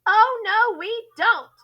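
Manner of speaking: put-on loud, high children's shout. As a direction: shout it back as a whole class. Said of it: "shouted the children".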